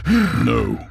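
sharp high cry of no; anguished no. Acted. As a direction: blurt it out in disbelief. Grandson's anguished denial.

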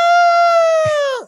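very high, loud celebratory woo; imitated woo. Kids celebrating in the other room, with a very high loud woo. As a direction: whoop it up. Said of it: "three seconds later".

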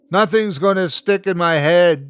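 high, loud upset protest; insist, close to a sob. A narrator's reading. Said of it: "His voice sounded like a sob".

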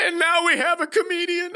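high, tearful announcer voice; mock crying. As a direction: sob out the introduction. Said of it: "start crying and go".

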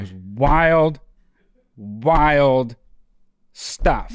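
WILD stretched long and leaned on, then STUFF set apart; drawn-out emphasis. Pretend. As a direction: draw out each "wild", pause, then land "stuff".

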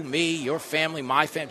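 ordinary delivery

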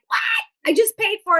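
the mom who just paid, indignant; exasperated mom. Retold or imitated. Mom reacting to her kid quitting, high exasperated outburst. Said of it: "And the mom's like".

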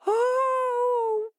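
very high drawn-out wail; wailing hare. Acted. Hare frightened by the monster, wailing. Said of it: "wailed Hare".

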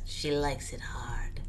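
slow breathy whisper. Real, not acted. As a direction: whisper it slowly like a secret. Joke title whisper.

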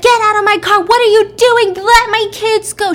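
high, loud, desperate demand; frantic yelling. Retold or imitated. Mother banging on car at the driver, frantic yelling. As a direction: yell at an intruder in acted panic.

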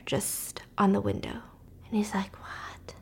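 soft, breathy whisper; suspense whisper. A narrator's reading. Building suspense for a friend, whispering. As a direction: whisper it like a ghost story.

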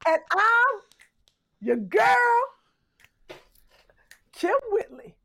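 hyped show intro. Acted. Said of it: "Too much?".

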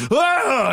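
a sudden waking gasp; startled gasp. Retold or imitated. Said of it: "cracked it and went".

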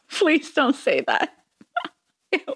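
giggling disgust at her own words; embarrassed laughing. Real, not acted.

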